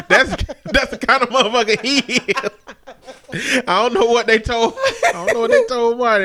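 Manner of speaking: laughing. Real, not acted. Laughing about brother's reaction.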